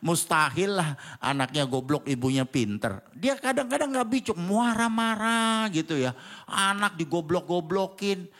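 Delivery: ordinary delivery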